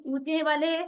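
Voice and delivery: usual speaking voice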